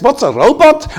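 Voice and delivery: high, astonished echo of the question; incredulous echo. Retold or imitated. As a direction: repeat it back high, amazed. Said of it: "And he says".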